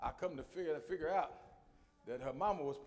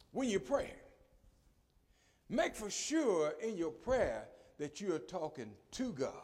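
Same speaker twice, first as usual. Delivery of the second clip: ordinary delivery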